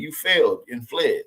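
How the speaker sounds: usual speaking voice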